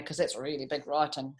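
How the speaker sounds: usual speaking voice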